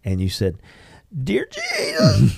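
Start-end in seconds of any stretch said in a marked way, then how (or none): 1.2-2.4 much higher, slow, voice breaking into tears; sobbing start of a prayer, voice cracking; choked-up crying. Retold or imitated. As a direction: imitate someone breaking down mid-prayer. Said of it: "I couldn't do it".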